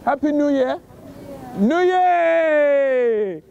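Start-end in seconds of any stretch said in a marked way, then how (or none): 1.6-3.4 long drawn-out cheer, falling at the end; rallying call. Real, not acted. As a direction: hold the last word out to rouse the crowd. Trying to rouse passers-by.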